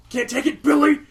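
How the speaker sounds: high, loud jeer building on the name; mock taunting shout. Imitating the cartoon frog mocking a crying kid, high loud taunt.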